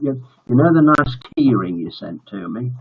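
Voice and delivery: ordinary delivery, nothing marked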